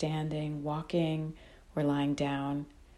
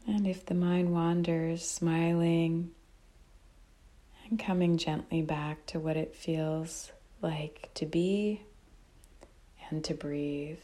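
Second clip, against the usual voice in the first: calm, unhurried meditation guide with long pauses; guided meditation; 5 dB quieter, slower than usual. Real, not acted. Teacher guiding listeners to notice breath and body, calm meditation voice. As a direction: guide them gently, phrase by phrase, leaving long silences.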